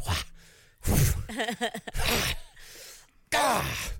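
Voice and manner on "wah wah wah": pitched-up mock wail of delight. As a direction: wail like a swooning fan. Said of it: "standing in front of the T V going".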